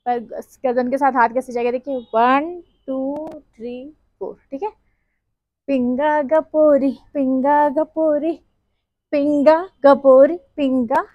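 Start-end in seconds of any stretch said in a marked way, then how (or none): 5.7-11.0 drawn-out slow chant of Pinga गपोरी; teaching the step slowly, each Pinga गपोरी drawn out; slow demo. Shown as a demonstration. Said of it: "ये मैं आपको slow में बता रही हूँ".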